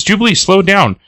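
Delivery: high acted alarm; urgent plea. Passenger to Jubilee in the runaway truck, acted alarm. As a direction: plead with the driver, pitched high. Passenger begs driver to slow.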